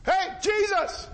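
high, drawn-out call; hollering. Acted. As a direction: holler to someone passing far off. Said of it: "So he starts a hollering".

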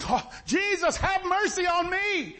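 high, drawn-out cry for mercy; acted shouting. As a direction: call out like a desperate blind beggar. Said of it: "after he starts shouting".

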